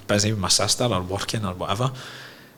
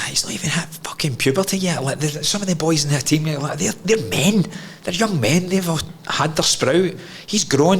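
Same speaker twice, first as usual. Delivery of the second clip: mock mum defending; 7 semitones higher than usual. Mum defending son to dad.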